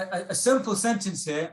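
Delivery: normal talking voice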